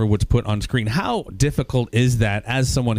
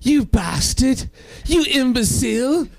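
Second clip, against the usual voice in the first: very high, drawn-out mock Australian; hammed-up Australian falsetto, slowly stretched; bad Australian accent; 13 semitones higher, slower than usual. Actress performing insults in her acting class, bad Australian accent. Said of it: "That's the worst Australian accent".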